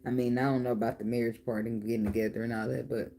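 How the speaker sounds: usual speaking voice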